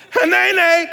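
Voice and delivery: high ringing call; proclaimed. Shown as a demonstration. The bush calling Moses.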